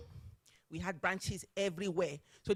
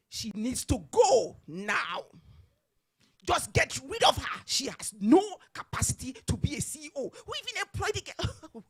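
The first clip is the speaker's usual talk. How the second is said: loud, emphatic mock order, rising higher; retold angry shouting; 4 semitones higher, 6 dB louder than usual. Chairman phoning HR to demand her dismissal, shouting.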